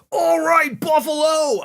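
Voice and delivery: mock rock-star hype shout; stage shout. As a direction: yell it like a singer hyping a crowd. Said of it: "the first thing out of Sam's mouth".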